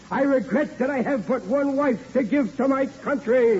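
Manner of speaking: slow, grand mock-solemn recital of a famous quote; mock patriotic declaiming. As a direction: ham it up like a pompous statesman. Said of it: "Nathan Hale was the fellow who said".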